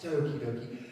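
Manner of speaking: normal talking voice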